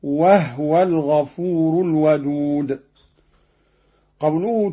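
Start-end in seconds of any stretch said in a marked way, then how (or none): 0.0-2.8 وهو recited as "wahwa" with the ha stilled; Qalun reading, silent ha. Shown as a demonstration. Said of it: "قرأ قالون بإسكان الهاء هكذا".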